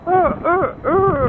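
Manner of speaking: high whinnying horse. Retold or imitated. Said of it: "horses go".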